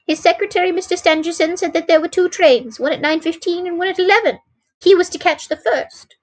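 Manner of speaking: lightly voiced woman, pitched high; agitated woman. A narrator's reading. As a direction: keep it high, holding agitation down. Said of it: "to keep down her agitation".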